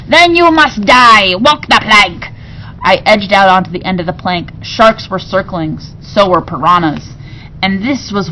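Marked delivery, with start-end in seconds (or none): 0.1-2.3 loud, slow death sentence; furious order. A narrator's reading. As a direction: roar the sentence. Pirate queen sentences him.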